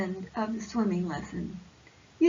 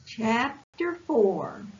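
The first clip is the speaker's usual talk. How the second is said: usual speaking voice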